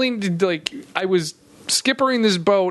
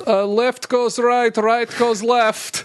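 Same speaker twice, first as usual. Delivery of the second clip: mock Croatian; 3 semitones higher, slower than usual. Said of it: "they're like".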